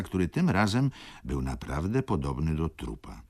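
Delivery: plain reading voice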